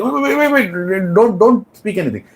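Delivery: high, urgent wait wait; agitated king halting the queen; king stopping her. Acted. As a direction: hold her off in a rush.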